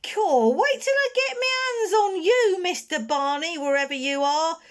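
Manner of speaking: eldest Snarget's threat. Acted. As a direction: threaten the boy hiding nearby. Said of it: "came the voice of the eldest Snarget".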